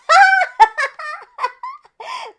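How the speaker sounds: a long high giggle; breathless high giggle fit; high giggling laugh. Acted. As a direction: laugh like you can't stop. Pirates can't stop laughing.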